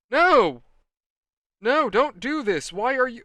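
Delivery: high, loud, drawn-out no; pleading protest. Retold or imitated. Storyteller replaying his desperate plea to a friend, loud and high.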